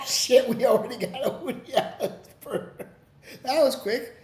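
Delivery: ordinary talk, nothing marked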